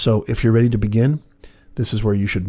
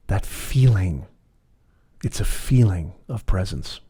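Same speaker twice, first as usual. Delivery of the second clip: measured, unhurried meditation voice; guided meditation. Acted. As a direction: lead them gently inward, phrase by phrase.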